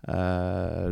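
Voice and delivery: ordinary talk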